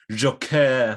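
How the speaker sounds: Joker said the French way, hammed up; jokey fake French accent; silly French accent. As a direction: play it like a mock Frenchman. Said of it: "saying Joker in a silly French accent".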